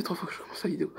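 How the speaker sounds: fast annoyed whisper. Real, not acted. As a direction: hiss it under your breath. Whispered aside after interruption.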